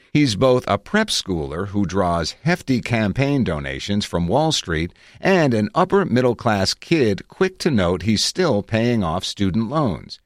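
ordinary delivery

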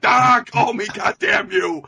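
high, loud mock outburst; imitated screaming. Imitating a frantic father shouting into his daughter's voicemail, mock screaming. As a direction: yell it like a father losing it. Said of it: "he's just screaming".

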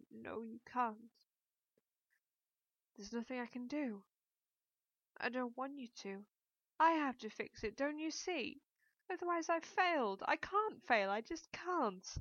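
words breaking through tears, heaving for air; sobbing. A narrator's reading. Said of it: "between sobbing and heaving for air".

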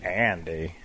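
low, soft narrator voice; a hint of Morgan Freeman; Morgan Freeman impression. Asked for Morgan Freeman, a low quiet Andy. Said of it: "Say something about Andy Dufresne".

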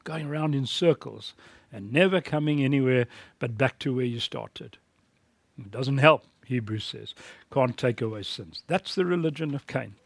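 ordinary delivery

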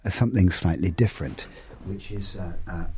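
fading off-mic; away from the microphone. Shown as a demonstration. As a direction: step back from the mic as you talk. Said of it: "move away from this microphone".

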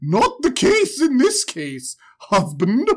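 a touch of snooty correction; haughty wife. Retold or imitated. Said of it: "And she's like".

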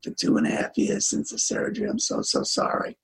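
whispered, choking up; a broken whispered apology; tearful confession. Retold or imitated. Father confessing his lies to his daughter, tearful whisper. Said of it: "I burst out crying".

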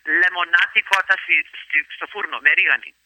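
the dish name said in Greek, sounds rolled out carefully; Greek pronunciation. Shown as a demonstration. Interviewer asks, she says the dish name in Greek. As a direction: say the Greek recipe name like a native speaker. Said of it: "say it again for me".

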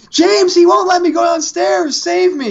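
squeaky distressed wife voice; pleading in a high falsetto; high-pitched damsel voice. Retold or imitated. Voicing wife calling for rescue.

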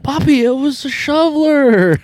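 high, slow drawn-out excuse; boy pleading innocence; slow innocent kid voice. Retold or imitated. Boy explaining to his grandfather, slow innocent voice.

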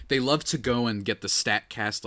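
ordinary talk, nothing marked

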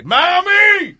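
high yelled call for mom; shouting for mom. Pretend. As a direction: yell "Mami" up high. Calls out to mom in bit. (grito agudo llamando a mami)

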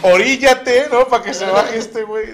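mock-yell it at the crew; mock shout. Retold mock-rude plane remark.

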